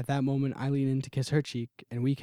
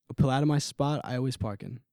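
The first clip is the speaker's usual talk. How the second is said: usual speaking voice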